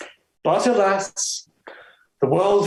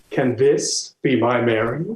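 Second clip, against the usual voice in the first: usual speaking voice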